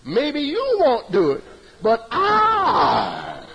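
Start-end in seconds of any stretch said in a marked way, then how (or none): 1.8-3.4 I shouted out loud; stress on I. Shown as a demonstration. Teacher stresses the speaker himself, loud emphatic I. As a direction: punch the I, loudly. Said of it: "If I say I".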